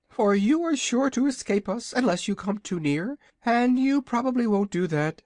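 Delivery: high, proud little dragon voice; a boastful baby dragon, pitched high; young dragonette. Acted. Dragonette speaks to visitors.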